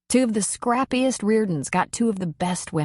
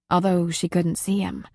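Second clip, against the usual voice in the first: usual speaking voice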